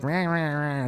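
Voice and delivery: garbled nonsense syllables, run together; mock mumbling. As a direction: imitate a granny's wordless mumbling. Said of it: "just fucking mumbling".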